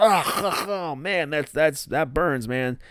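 high strained mock gasp at the burn; hammed-up burning throat; faking the burn. [name] pretending the bourbon burns, mock gasp. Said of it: "pretends like the bourbon really burns".

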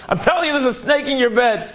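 put-on girl insisting to her mother, frightened; insistent fright. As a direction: make her believe you, alarmed. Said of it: "She was shrieking".

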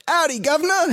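hammed-up British pub bloke, high; a bad British accent, played for laughs. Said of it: "then he had a British accent".

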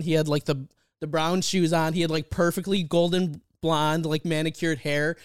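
ordinary talk

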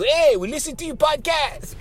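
lightly voiced TikTok follower saying hi; mimicked listener. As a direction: chirp it like an eager fan. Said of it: "There's some people on TikTok that go".

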